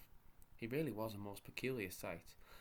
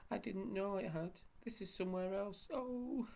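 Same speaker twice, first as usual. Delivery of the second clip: high panicked saucepan man; 11 semitones higher than usual. A narrator's reading.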